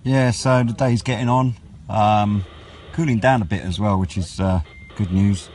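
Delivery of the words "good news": normal talking voice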